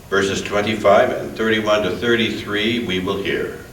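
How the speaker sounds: normal talking voice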